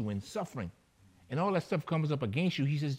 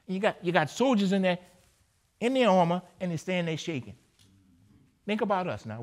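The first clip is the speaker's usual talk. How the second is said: ordinary talk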